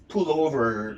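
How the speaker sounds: "Pull over" in an affected foreign accent; put-on foreign accent. Shown as a demonstration. Journalist showing what an accent is, affected foreign accent. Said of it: "Now that's an accent".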